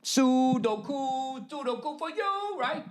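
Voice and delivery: high, soft, slow mock Sudoku; mock fancy voice. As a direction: coo it like a prissy puzzle snob. Mocking puzzle lovers.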